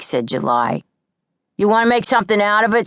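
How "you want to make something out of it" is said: fast, louder challenge; picking a fight. A narrator's reading. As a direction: square up to her, fast.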